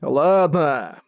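curt sullen grumble; angry grumble. Acted. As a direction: bite the word off grudgingly. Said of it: "злобно буркнул Джарнак".

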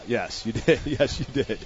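ordinary talk

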